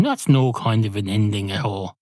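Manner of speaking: ordinary talk, nothing marked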